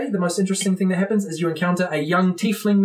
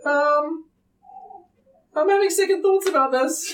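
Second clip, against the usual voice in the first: high, wavering second thoughts; hesitant young wizard; 9 semitones higher than usual. Acted. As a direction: back off nervously in a high voice. Dingar losing nerve.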